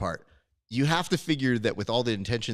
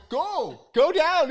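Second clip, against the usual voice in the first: high, loud, insistent call; urgent urging; 6 dB louder, much slower than usual. Retold or imitated. Neighbour urging the called contestant down, high shout. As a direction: holler at a frozen winner to move. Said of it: "And I go".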